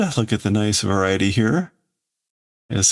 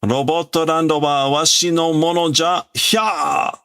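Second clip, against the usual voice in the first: put-on high, shouting old man claiming his land; hammed-up villain tantrum; raging old villain; 8 semitones higher than usual. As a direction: bellow ownership at the robots.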